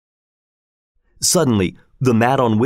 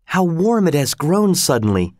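higher, a touch puzzled at the heat; mild surprise; 6 semitones higher than usual. A narrator's reading. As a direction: notice the sudden warmth. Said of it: "And he thought".

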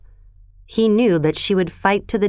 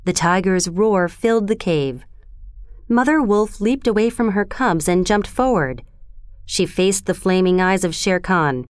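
usual speaking voice

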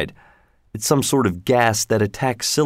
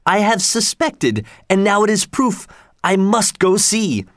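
excited exclamation; 10 semitones higher than usual. A narrator's reading. Leroy reacting to proof of silicon life, excited exclamation. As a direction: exclaim it high, stretching the words. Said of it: "shouted Leroy".

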